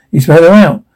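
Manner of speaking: usual speaking voice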